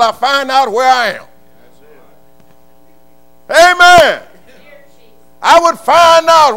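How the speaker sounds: loud, high shouting preacher; preaching at full force. Real, not acted. Preacher shouting to his church about God's leading. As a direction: let it ring out over the congregation.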